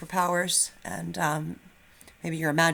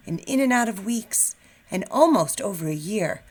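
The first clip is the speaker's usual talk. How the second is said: lively picture-book read-aloud; animated storybook narration; reading to children. A narrator's reading. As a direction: read it like storytime for first graders. Teacher reads picture book aloud.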